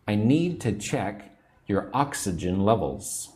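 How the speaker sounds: usual speaking voice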